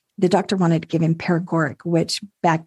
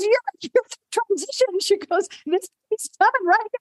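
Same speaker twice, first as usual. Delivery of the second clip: urgent nurse; 13 semitones higher than usual. Retold or imitated.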